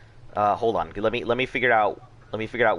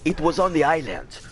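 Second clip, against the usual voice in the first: mock dramatic; 2 semitones higher than usual. Dramatic claim of island heart.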